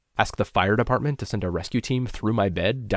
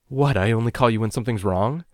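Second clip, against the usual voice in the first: higher, louder forced joking; trying to joke; 4 semitones higher, 7 dB louder than usual. A narrator's reading. Tearful caller to worried mother, attempted joking. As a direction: make a joke of it while near tears. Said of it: "I tried to joke".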